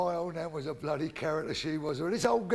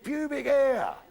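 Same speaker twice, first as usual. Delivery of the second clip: high, loud schoolboy repeat; emphatic repeat; 9 semitones higher, 6 dB louder, much slower than usual. Acted. Schoolboy asked to repeat himself to teacher, loud and emphatic. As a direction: repeat it higher and louder for teacher. Boy repeats after "beg your pardon.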